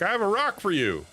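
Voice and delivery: hammed-up offer, falling away at the end; eager suitor. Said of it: "Be like".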